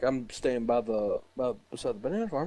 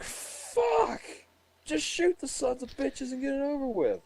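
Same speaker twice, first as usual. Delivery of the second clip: exasperated outburst. Retold or imitated. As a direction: blow up at the endless delay. Exasperated imagined outburst at delay.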